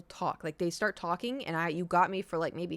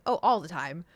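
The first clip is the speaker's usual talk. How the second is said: peppy; 3 semitones lower, 4 dB louder than usual. Retold or imitated. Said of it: "a little too, like, peppy".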